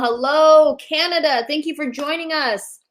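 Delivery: ordinary talk, nothing marked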